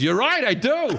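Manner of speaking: lightly voiced excitable movie star; Al Pacino. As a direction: agree high and emphatically. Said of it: "He goes".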